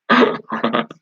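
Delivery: a real burst of laughter; laughing. Real, not acted. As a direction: laugh out, unable to hold it in. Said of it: "You guys have never seen me laugh before".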